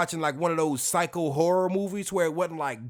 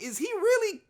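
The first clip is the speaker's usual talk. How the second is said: high, incredulous gasp of a question; stunned, high-pitched disbelief; shocked disbelief; 8 semitones higher than usual. Retold or imitated. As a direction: ask it like you can't believe your eyes. Shocked reaction while watching.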